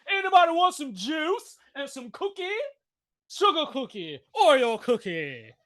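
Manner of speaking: high, laughing taunt as the market; giggly mocking voice of the market. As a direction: tease like a kid offering cookies. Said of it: "the market is gonna say".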